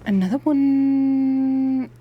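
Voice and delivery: sing-song the words out slow; drawn-out singsong. Real, not acted. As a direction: stretch it slowly, half sung. Drawn-out delight at find.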